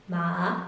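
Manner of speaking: "Ma" rising in pitch; second tone. Shown as a demonstration. Teacher modelling for repetition, "Ma" sliding up. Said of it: "Repeat after me".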